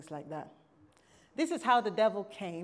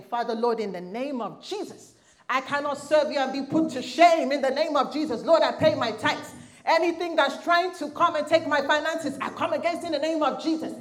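loud, higher pleading prayer in the car; fervent loud prayer; 6 semitones higher, 10 dB louder than usual. Retold or imitated. Praying aloud while driving, loud and fervent. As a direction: cry out to God with full force.

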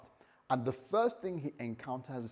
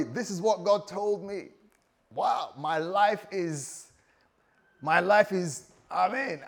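higher pitch, stretched amazed phrases; excited Abraham; 4 semitones higher, 4 dB louder, slower than usual. Acted. Abraham telling everybody what God told him, excited. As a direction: act out someone overjoyed at big news. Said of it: "he was so excited".

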